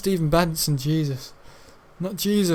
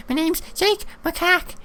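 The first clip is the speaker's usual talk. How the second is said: high, silly cartoon voice; mock monkey voice; 12 semitones higher than usual. Mocking old classmate Jake, high silly voice. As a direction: squeak it out like a goofy monkey.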